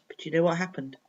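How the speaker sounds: ordinary delivery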